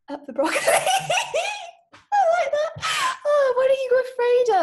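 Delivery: high, loud, squealing laughter; giggling fit. Acted. Applicant amused by her silly answer, high helpless giggling. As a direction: crack up and read on through the giggles.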